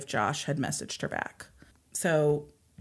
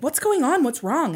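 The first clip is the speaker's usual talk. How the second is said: higher, urgent questioning; retold urgent dad, pitched up; concerned dad; 9 semitones higher, 4 dB louder than usual. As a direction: imitate an alarmed father asking. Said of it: "he grabbed her and was like".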